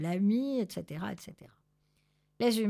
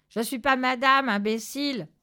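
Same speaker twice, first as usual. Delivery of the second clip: loud, mildly raised rebuke; angry cat retort; 5 semitones higher, 7 dB louder than usual. A narrator's reading. Zorbas corrects the two thugs, angry. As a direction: snap back loudly at an idiot. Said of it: "miaula Zorbas en les tirant par les oreilles".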